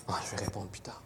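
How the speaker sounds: a hushed, breathy whisper; whispered aside. Acted. Distracted believer whispering mid-prayer about a text, hushed. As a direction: mutter it under your breath, distracted. Praying man ignores a text.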